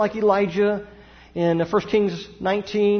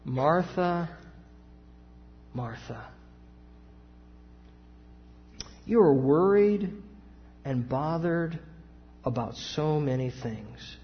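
soft, gentle correction, lightly voiced; tender; 3 semitones lower, 6 dB quieter than usual. Jesus gently tells worried Martha she is bothered, soft and tender. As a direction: speak her name softly, like calming a stressed friend. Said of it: "Can you hear the tenderness of his voice?".

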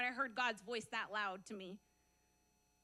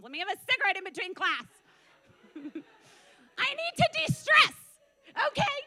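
higher, louder, half-laughing whine; retold exasperated plea; 6 semitones higher, 10 dB louder than usual. Student begging Jesus for a smoke between classes, exasperated wail. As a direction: wail it over the top, mocking your own excuse.

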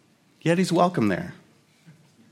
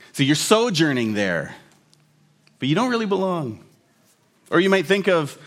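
usual speaking voice

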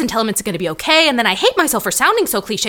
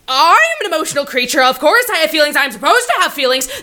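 excited rush; 6 semitones higher, 3 dB louder, faster than usual. Acted. Excited defence of feelings.

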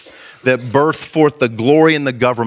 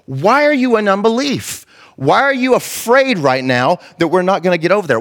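higher-pitched, indignant questioning; acted rebuke; 6 semitones higher than usual. Jesus to frightened disciples in the boat, acted rebuke. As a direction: rebuke them, pitch raised. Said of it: "He rebukes them and says".

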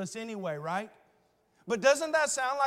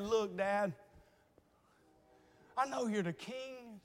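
tender plea; 2 semitones higher than usual. Acted. Jonathan imagined pleading with his father.

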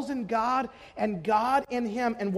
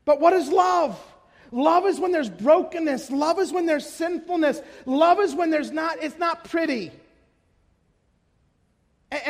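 raised, driving sermon voice; fervent preaching; 4 semitones higher, 4 dB louder than usual. Acted. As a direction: preach it out with full force. Preaching at full force.

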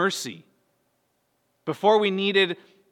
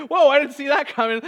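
high, loud, laughing surprise; hammed-up shock with a laugh; mock astonishment; 10 semitones higher, 8 dB louder than usual. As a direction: play caught completely off guard for laughs. Imagined Jesus caught off guard.

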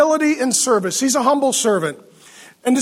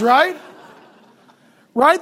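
usual speaking voice

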